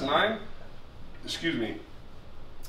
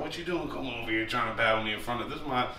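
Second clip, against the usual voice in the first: Harry's raspy voice; 2 semitones lower, 7 dB quieter than usual. Retold or imitated. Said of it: "he's always had that raspy ass voice".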